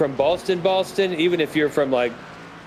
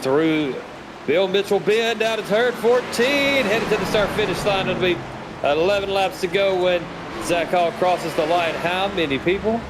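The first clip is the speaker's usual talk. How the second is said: live play-by-play; 3 semitones higher than usual. Acted. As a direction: call the lap to the line for the listeners.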